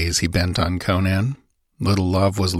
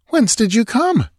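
high-pitched, insistent question; demanding woman. A narrator's reading. Sancha questions the dripping intruder, high and demanding. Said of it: "she demanded".

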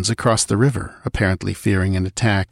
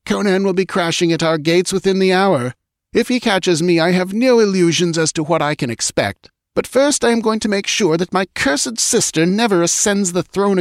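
high-pitched queen, grimly resolved; urgent scheming queen; 11 semitones higher than usual. Acted. False queen orders her slave to follow, urgent and vengeful. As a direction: play a defeated queen bent on revenge, pitched high.

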